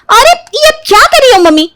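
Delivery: high, shocked outcry; startled protest. Acted. Daughter protesting as mother pulls earphones. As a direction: react to the earphones yanked.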